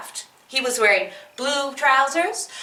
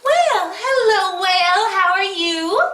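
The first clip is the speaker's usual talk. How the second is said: high, loud, slow fish voice; small fish voice; 5 semitones higher, 3 dB louder than usual. Acted. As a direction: sing out a cheery greeting.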